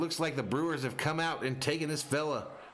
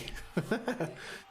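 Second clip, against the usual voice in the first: high, soft chuckle; short amused laugh after reading a message; 9 semitones higher, 3 dB quieter than usual. Real, not acted. Announcer reacts to a viewer's chat, soft laugh.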